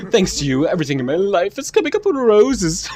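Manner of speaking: high, excited character voice; overjoyed game character. Acted. Reads a grateful game character's lines.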